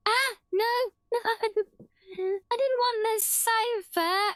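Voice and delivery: high, slow dismayed wail as the toy falls; a real yelp of dismay, pitched well up; dismayed cry. Real, not acted. Streamer watching a teddy bear tumble, real high dismayed cry.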